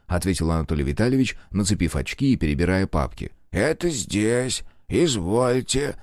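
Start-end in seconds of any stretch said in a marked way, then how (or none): 3.5-6.0 put-on slow, high old man's mumble; old clerk voice. A narrator's reading. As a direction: drawl it like a frail old clerk. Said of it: "прошамкал старик".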